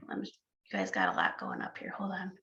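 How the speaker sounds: very soft, rushed, half-whispered aside; distracted murmur to yourself; muttered aside. Real, not acted. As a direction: mumble it quickly under your breath. Aside while reading incoming chat.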